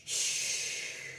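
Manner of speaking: long, soft voiceless shh; breath released as a hiss; long hushing exhale. Shown as a demonstration. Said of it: "blow out".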